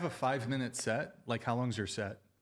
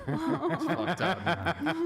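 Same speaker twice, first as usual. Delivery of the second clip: laughing; 10 semitones higher, 4 dB louder, much slower than usual. Real, not acted. Laughing reaction to donation.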